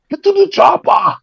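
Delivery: put-on very high, strained action-hero yell with an Austrian accent; Arnold Schwarzenegger. Comic mocking an action star's formula, hammed-up Austrian impression. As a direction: holler it like a hammed-up movie catchphrase. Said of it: "It worked for Arnold Schwarzenegger".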